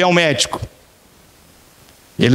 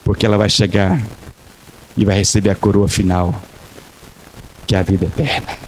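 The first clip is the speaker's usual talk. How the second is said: normal talking voice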